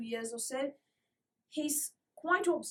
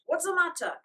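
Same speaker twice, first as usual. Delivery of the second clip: cried out; 8 semitones higher, 7 dB louder than usual. A narrator's reading. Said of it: "cried Mister King".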